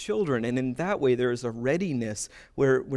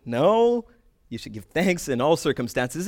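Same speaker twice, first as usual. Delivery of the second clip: mock exasperated correction; 3 dB louder than usual. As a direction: reject it with a hammed-up no. Acted sketch-style correction of confused person.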